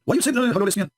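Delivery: put-on high, indignant girl's voice; offended girl. The girl demanding an explanation, high and indignant. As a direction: pitch it up, offended.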